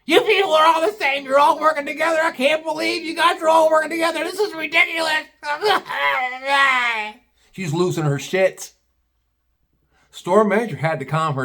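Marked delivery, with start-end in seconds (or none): 0.0-5.2 shrill-pitched furious customer imitation, higher; high screaming customer. Raging customer screams at the store manager, much higher. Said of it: "She starts screaming at the store manager". 5.3-7.1 high laugh. Real, not acted. Laughing after imitation.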